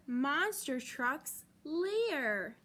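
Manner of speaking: usual speaking voice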